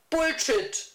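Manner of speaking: kurz und derb ausgestoßen; leicht gespielter derber Fluch; fluchend. A narrator's reading. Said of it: "fluchte sie wie ein Kerl". (ein Hauch von Kerl im Fluchen)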